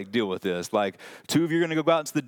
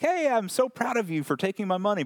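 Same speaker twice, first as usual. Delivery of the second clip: mock proud master; 8 semitones higher than usual. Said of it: "so this is not like".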